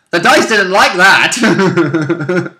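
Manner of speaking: slow, laughing through the words; laughing quip. Retold or imitated. Player joking about his dice roll, slow and laughing. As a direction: chuckle it out, amused. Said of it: "I was like".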